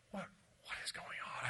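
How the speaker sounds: hushed, frightened whisper; scared whisper. Retold or imitated. Six-year-old on the couch, whispering in fear. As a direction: whisper it toward the closed door. Child walking to parents' room.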